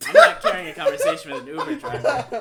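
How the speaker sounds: loud laughing outburst, high pitched. Real, not acted. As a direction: blurt it out laughing. Laughing through roleplay line.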